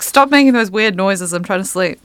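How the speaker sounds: mild mock complaint, easing off at the end; mock annoyed dog. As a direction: complain like a dog kept from sleeping. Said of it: "he gets so annoyed".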